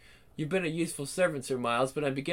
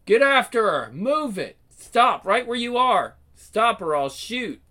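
louder barked orders in a chase; shouted commands; 4 semitones higher, 5 dB louder than usual. Acted. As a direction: yell at the runners to stop. Police chase, calling out.

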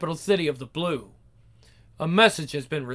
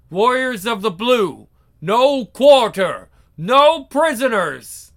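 slow, loud war command; battle cry; 5 semitones higher, 6 dB louder, slower than usual. Acted. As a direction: growl the order to charge.

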